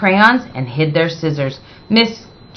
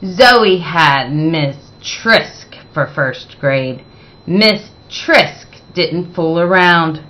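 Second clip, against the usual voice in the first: usual speaking voice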